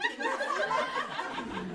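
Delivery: high voiceless burst of laughter; a gasping, airless laugh; breathless laugh. Real, not acted. Scene: telling the museum surprise to listeners, breathless laugh. Amazed at seeing old schoolmate.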